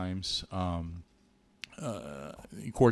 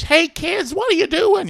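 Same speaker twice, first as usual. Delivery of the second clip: very high, louder bird-puppet voice; Big Bird; 11 dB louder than usual. Retold or imitated. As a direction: chirp it up high like a friendly giant bird. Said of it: "talking in his Big Bird voice".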